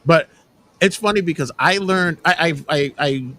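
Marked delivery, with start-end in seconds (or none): none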